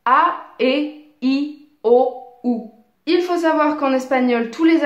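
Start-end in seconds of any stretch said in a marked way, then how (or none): 0.0-2.6 A, E, I, O, U said crisply as pure Spanish vowels; Spanish vowels A E I O U, pronounced separately and cleanly. Shown as a demonstration. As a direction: model each vowel clearly like a teacher. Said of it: "Ces sons sont".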